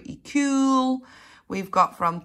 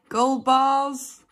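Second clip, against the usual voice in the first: ordinary delivery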